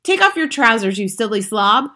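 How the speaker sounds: ordinary delivery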